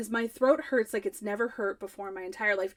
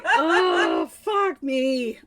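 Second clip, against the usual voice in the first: exasperated groan; 2 semitones higher, 5 dB louder, much slower than usual. Retold or imitated. Wife reacting to husband's put-down, exasperated outburst. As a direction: give up in theatrical offence.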